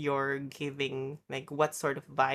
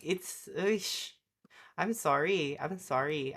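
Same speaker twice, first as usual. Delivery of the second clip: normal talking voice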